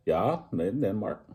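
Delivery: put-on a clumsy try at a Danish accent. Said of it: "Probably the worst Danish accent ever done".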